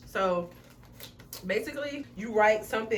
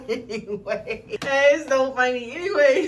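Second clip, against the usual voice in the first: slow, loud laughing words; giggling through the words; 5 semitones higher, 10 dB louder, slower than usual. Real, not acted. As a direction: laugh it off and move on. Laughing off the surprise.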